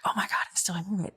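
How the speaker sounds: fast frightened whisper; a scared woman whispering alone in bed; panicked whisper. Retold or imitated. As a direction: rush it out under your breath in fear. Scared in bed, hearing noises.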